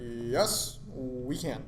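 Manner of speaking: a light Obama rally line; Obama slogan impression. Answering friends, a light mock Obama slogan. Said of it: "That's better".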